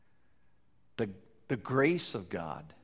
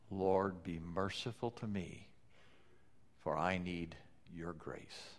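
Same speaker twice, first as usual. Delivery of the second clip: humble prayer; 3 semitones lower, 8 dB quieter than usual. Retold or imitated.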